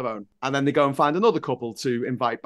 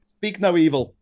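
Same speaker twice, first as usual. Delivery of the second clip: punchy, louder film title; dramatic title; 5 semitones higher, 5 dB louder than usual. Real, not acted. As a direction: announce the title with relish. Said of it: "That's my Halloween film".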